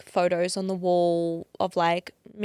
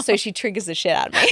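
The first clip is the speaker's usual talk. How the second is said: fast, laughing aside; 11 semitones higher, 10 dB louder, faster than usual. Real, not acted. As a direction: rush it out through a laugh. Laughs about daughter.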